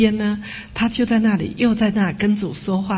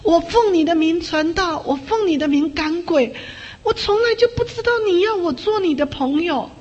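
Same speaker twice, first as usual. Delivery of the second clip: higher, impassioned prayer cry; crying out to God; 10 semitones higher than usual. Retold or imitated. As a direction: cry out to God kneeling in the snow. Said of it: "跪在那里跟主大喊".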